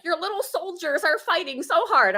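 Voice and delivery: high, sugary comforting voice; chatbot voice. Retold or imitated. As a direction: gush like a cheery chatbot. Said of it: "Chat G P T was like".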